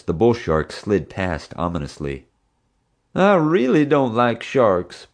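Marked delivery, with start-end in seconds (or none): 3.1-5.1 high, slow, scared whimper. A narrator's reading. Bubba staring up at the sharks, slow frightened whimper. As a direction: whine it out as if near tears. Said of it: "Bubba whimpered".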